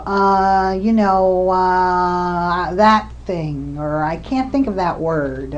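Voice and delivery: demonstrating a search for words with drawn-out uhs; hesitant, filler-laden. Shown as a demonstration. Clinician imitating a patient's word-finding trouble, hesitant with long fillers. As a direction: stretch the fillers, groping for the missing word. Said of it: "Often the speech is slow and hesitant".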